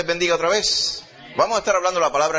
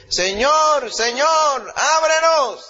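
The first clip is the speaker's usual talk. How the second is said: high, loud desperate plea at the door; pleading cry; 11 semitones higher, 5 dB louder, much slower than usual. A narrator's reading. Shut-out virgins begging the Lord at the door, loud high cry. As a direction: cry out to be let in.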